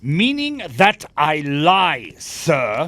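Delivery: loud, slow, bristling indignation; daring him to say it; offended challenge. Acted. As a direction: spit each word back at him. Gibson takes offence at accusation.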